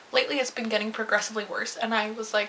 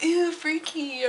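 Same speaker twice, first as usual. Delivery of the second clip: slow, higher disgusted drawl; mock grossed-out coworker; 6 semitones higher, much slower than usual. Store staff gawk at her pupils, mock disgust. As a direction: pull a face at the freak eyes.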